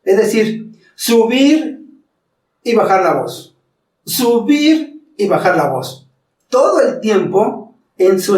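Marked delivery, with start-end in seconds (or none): 0.9-3.6 "subir" pitched up, "y bajar la voz" dropped back down; raising and lowering voice. Shown as a demonstration. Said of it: "son los cambios de voz". 4.0-6.1 raising and lowering voice. Shown as a demonstration. Coach demonstrates voice changes, slow rise on "Subir" then a drop. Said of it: "son los cambios de voz".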